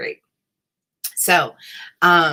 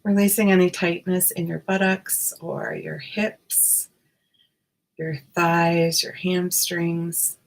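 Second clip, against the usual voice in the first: guided meditation. Acted. Guided meditation body scan.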